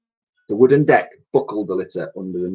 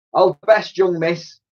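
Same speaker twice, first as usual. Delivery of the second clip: mock heroic farewell; 7 semitones higher than usual. Titch saying goodbye.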